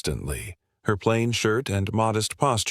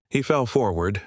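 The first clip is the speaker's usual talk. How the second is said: plain reading voice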